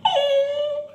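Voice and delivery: a very high, slow crying whimper; crying squeak of fear. Retold or imitated. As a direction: whimper in fake terror. Frightened reaction.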